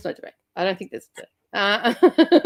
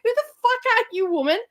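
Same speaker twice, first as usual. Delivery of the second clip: raised, higher mock rant; retold telling-off; 7 semitones higher than usual. Telling off the rival in her own shop, mock angry. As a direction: snap it at the intruder, half-laughing. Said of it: "And I'm like".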